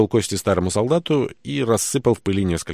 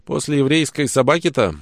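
sneering; 8 semitones higher, 5 dB louder than usual. Acted.

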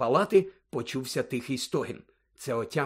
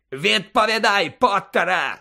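loud, higher enraged demand; furious yell; 6 semitones higher, 6 dB louder than usual. A narrator's reading. Snape demanding an answer from Potter, yelled furiously. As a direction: yell it like a man in a rage. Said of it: "зарепетував він".

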